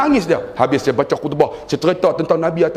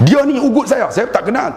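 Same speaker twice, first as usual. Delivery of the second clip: alarmed; 10 semitones higher than usual. Real, not acted. Alarm at the threat.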